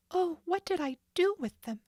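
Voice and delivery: put-on high, anxious girl's voice; frantic young Nancy. Nancy hunting for lost keys, frantic. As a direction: fret aloud in a rising panic. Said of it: "she thought frantically".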